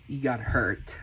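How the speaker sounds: ordinary talk, nothing marked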